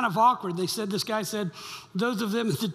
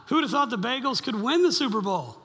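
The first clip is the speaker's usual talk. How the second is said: usual speaking voice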